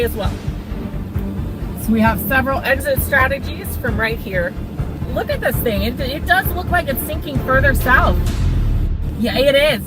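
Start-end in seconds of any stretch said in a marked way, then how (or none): none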